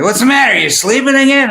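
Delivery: high, amused put-on voice of an old friend; mock friend voice with a laugh; imitating a friend. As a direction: tease like a late-night caller, pitched high. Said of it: "And he'll say".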